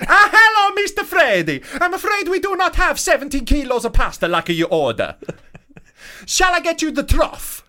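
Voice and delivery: high, loud hammed-up Italian accent; hammed-up Italian waiter with laughs breaking through; very high, loud mock Italian waiter, laughing. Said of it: "an accent I can do".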